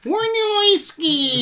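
Wynorski stretched slow and high; drawn-out Wynorski. Acted. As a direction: say Wynorski slowly, sing-song. Mocking the director's name.

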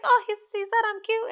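high excited mock squeal; giddy high voice. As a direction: squeal it like a lovestruck kid. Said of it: "She was like".

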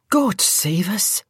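slow, drawn-out Scottish sigh of dismay; Scottish nurse reacting to the patient; Scottish exclamation. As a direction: let it out slowly in Scots. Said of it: "the voice said".

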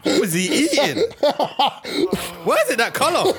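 high-pitched question through laughter; helpless laughing disbelief; cracking up. Real, not acted. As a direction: squeal it out while laughing. Cracking up over the video.

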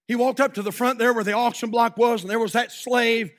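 loud, rising sermon storytelling; fiery revival preacher telling a story; preaching at full force. Acted. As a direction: preach it to the whole room. Preacher tells slave auction story.

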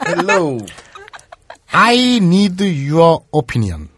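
slow, careful broken English; drawn-out Konglish line; Korean accent English. Acted. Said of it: "완벽한데?".

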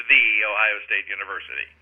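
"The" said as THEE, softly; soft delivery, "The" with a long E. Shown as a demonstration. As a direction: say "The" like "thee" before Ohio. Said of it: "it's pronounced The as the first".

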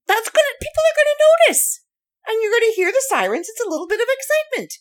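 high, fast and giddy; delighted by the small-town drama; bubbly and quick; amused excitement. Real, not acted.

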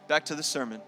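ordinary delivery

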